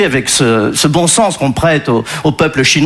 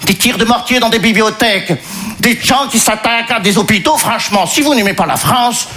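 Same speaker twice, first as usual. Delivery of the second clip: lightly voiced Congolese Belgian accent, pitch higher; a hint of a Congolese accent, complaining; 5 semitones higher than usual. Disappointed migrant complains to Régis in a light Congolese Belgian accent. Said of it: "Il vient du Congo belge".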